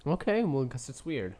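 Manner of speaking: normal talking voice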